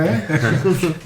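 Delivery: bright rising laugh. Real, not acted. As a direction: burst out laughing. Laughing at own joke.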